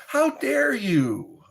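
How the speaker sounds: high, slow, hammed-up "How dare you?"; mock outrage. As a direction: play scandalized, pitch way up.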